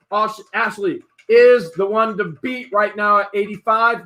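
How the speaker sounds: fast, high, loud auctioneer calling the bids; rising excitement as the clock runs out; live auction play-by-play. Acted. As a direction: hype the bidding war like a live auctioneer.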